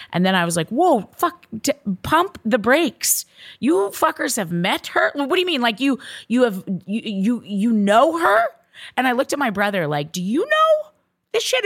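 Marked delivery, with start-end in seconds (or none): 0.7-8.5 high shocked demand for answers; shocked disbelief. Retold or imitated. Shock at hidden sister news. 10.1-11.6 very high, stunned question; high, stunned, wide-open question; incredulous. Retold or imitated. Asking brother in disbelief.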